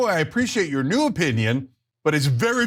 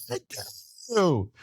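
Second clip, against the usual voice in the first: mock outrage; 6 dB quieter than usual. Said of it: "they said".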